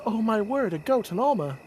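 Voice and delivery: lightly voiced excited girl; Sophia voice. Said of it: "Sophia turns around and goes".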